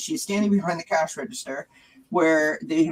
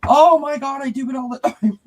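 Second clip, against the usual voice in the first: put-on cheerful old lady; 8 semitones higher, 7 dB louder than usual. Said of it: "But she was like".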